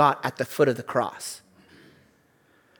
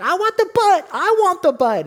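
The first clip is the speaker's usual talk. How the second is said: high, pleading little whine; wife's childish whine; 5 dB louder than usual. Retold or imitated. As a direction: beg for it like a kid wanting the treat. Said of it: "She's like".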